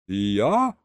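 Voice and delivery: ordinary delivery, nothing marked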